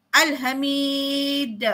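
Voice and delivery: "Al-Hamid" very slow, with a strong bounce on the final d; qalqalah kubra demo. Shown as a demonstration. Teacher showing the students a strong qalqalah bounce on the final d. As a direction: stretch out "Al-Hamid" and bounce the d hard. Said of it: "menjadi qalqalah kubra besar".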